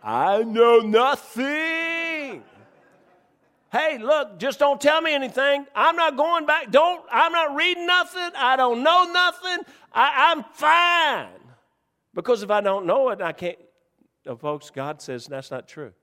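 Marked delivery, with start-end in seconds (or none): 0.0-2.4 very slow, drawn-out, shouted comic denial; Sergeant Schultz. Retold or imitated. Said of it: "let me be Sergeant Schultz". 3.7-11.4 higher, mock-stubborn refusal, hands over ears; wilfully ignorant sinner. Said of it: "let me be Sergeant Schultz".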